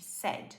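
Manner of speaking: "said" whispered as sed. Shown as a demonstration. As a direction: breathe out "said" as sed. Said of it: "igual triste".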